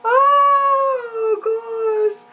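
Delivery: a real break into tears, pitch jumping up; real tearful. Real, not acted. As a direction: let the tears catch you mid-laugh. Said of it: "I don't mean to get all emotional".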